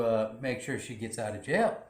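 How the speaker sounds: ordinary talk, nothing marked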